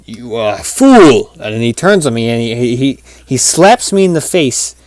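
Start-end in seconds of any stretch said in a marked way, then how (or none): 0.1-1.3 slow mock-villain insult, swelling on the last word; hammed-up dog villain; scornful dream dog. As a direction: build to a loud, stretched FOOL.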